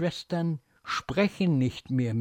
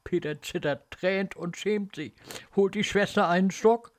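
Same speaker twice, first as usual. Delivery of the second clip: ordinary delivery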